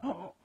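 high, sharp intake of breath; acted-out gasp of surprise; startled gasp. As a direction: gasp as if caught off guard. Acting startled reaction to monk.

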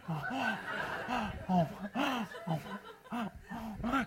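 soft, breathy startled oh's; mock startled gasps. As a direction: gasp like you've been caught off guard. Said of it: "they're in the street like".

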